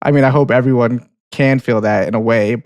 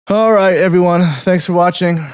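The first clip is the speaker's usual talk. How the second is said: upbeat sign-off; 6 semitones higher than usual. Real, not acted. Host signs off the show.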